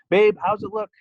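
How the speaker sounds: high, loud call to someone nearby. Acted. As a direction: call out to Zach from a distance. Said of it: "Janine called".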